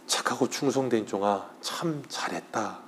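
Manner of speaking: very soft, slow commendation; tender praise. Retold or imitated. The Lord welcoming a faithful servant, soft and slow. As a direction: praise the servant gently and slowly.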